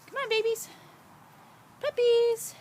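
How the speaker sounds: high loud sing-song call. Real, not acted. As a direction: call the puppies in a bright coo. Calling puppies.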